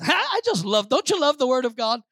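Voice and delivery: normal talking voice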